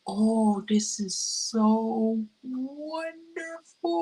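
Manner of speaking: high, very drawn-out, moved voice; slow, rising, overcome-with-emotion imitation; imitating sister's tearful delight. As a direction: act out someone touched to tears by a gift. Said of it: "I'm tearing up here a little bit".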